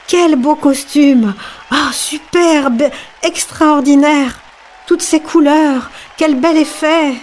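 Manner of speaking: high, slow, drawn-out cries of admiration; crowd exclaiming. A narrator's reading. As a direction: gush over the invisible costume, slowly and high. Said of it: "chacun s'exclama". (exclamations admiratives, aiguës et lentes)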